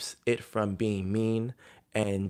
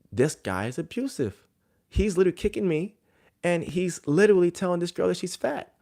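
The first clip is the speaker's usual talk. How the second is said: higher-pitched, incredulous inner voice; retold disbelief; 9 semitones higher, 3 dB louder than usual. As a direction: replay your appalled disbelief, pitched up. His shocked inner reaction, retold.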